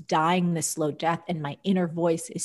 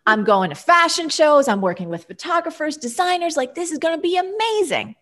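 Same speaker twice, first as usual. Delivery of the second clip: excited; 10 semitones higher, 4 dB louder than usual. Retold or imitated. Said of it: "I'm like".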